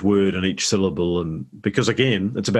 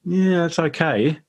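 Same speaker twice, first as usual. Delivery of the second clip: high, drawn-out, reluctant approval; imitated lukewarm verdict; 9 semitones higher, slower than usual. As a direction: mimic an unconvinced Scottish engineer, slowly and high. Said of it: "the engineer was Scottish, and he was like".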